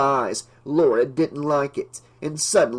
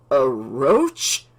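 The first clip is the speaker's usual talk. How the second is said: incredulous. A narrator's reading. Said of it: "wide-eyed".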